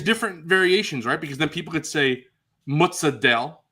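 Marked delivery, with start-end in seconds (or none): 2.7-3.6 mozzarella as "moot-suh-DELL"; super New York Italian. Shown as a demonstration. Co-host demonstrating, super New York Italian "moot-suh-DELL". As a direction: clip the final vowel off mozzarella, New York Italian style. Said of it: "super New York Italian".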